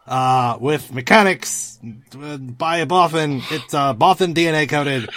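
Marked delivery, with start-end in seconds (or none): none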